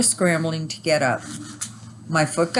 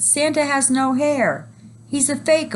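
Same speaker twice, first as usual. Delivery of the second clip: shocked yell; 10 semitones higher than usual. A narrator's reading. Boy in line discovering fake Santa, high yell. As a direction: yell it like a betrayed child. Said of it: "a boy yelled".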